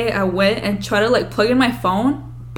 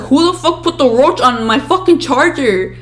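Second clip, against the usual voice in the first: loud, higher-pitched outraged demand; angry yelling, retold; 5 semitones higher, 6 dB louder than usual. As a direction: act out demanding the culprit. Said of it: "like, I scream".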